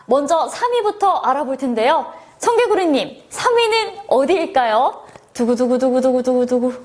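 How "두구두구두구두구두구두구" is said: rapid, even-pitched "dugu-dugu" drum roll; vocal drum roll. Pretend. Host teasing the third-place reveal, imitated drum roll.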